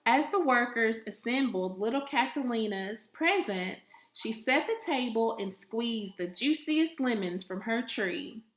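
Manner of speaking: ordinary delivery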